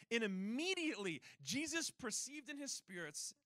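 ordinary delivery

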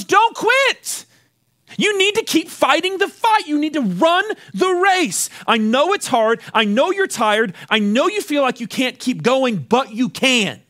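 high, forceful rallying cry easing into firm urging; impassioned battle-leader pep talk; rousing exhortation. Acted. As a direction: get in their face and urge them on. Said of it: "someone with blue face paint to get right up in front of us and".

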